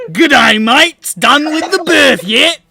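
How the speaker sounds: loud, slow Australian cultist; hammed-up Aussie greeting; cheery Australian accent, loud and slow. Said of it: "you are mimicking them".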